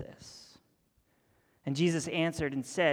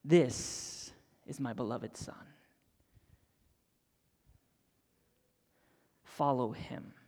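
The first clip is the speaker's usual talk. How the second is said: mild voice of God; 5 semitones lower, 4 dB quieter than usual. Retold or imitated. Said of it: "God cries out".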